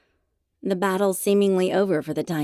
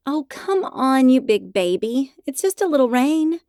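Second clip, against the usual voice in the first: coaxing high voice; 7 semitones higher, 5 dB louder than usual. Acted. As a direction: sweet-talk a nervous dog. Coaxing scared dog in rain.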